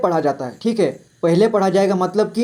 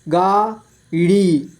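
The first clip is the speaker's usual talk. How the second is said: गाड़ी split slowly into गा, ड़ी; syllable by syllable; much slower than usual. Shown as a demonstration. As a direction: stretch and separate गा and ड़ी in गाड़ी. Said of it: "इस तरह से आपको". (गाड़ी को तोड़कर धीरे: गा, ड़ी)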